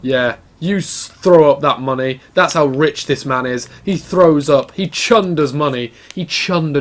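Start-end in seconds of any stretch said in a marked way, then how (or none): none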